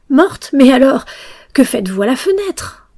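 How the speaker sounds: loud, high astonished question; startled outcry. A narrator's reading. Pinocchio questions the dead girl at the window, startled outcry. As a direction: burst out in disbelief.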